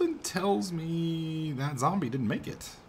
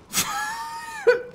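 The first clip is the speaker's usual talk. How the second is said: high laugh. Real, not acted. Player laughing after a game scare, high squealing laugh.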